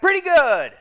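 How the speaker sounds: retold shouted answer; shouted reply. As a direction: holler it back casually. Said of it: "The other shouted back".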